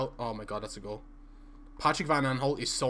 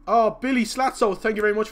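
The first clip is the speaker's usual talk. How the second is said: excited shoutout; 5 semitones higher, 8 dB louder than usual. Real, not acted. Thanking a donor on stream.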